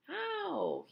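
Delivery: high, drawn-out yelp of pain; high pained cry; cried out. A narrator's reading. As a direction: yelp as if struck. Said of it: "he cried".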